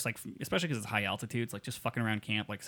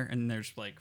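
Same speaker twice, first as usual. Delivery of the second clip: ordinary talk, nothing marked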